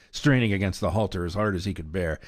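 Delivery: ordinary delivery, nothing marked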